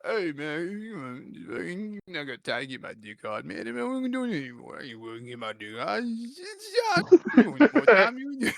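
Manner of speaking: put-on mumbled punch-drunk Rocky. Rocky refusing the street fight, dopey mumbled mock voice. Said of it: "Rocky's like".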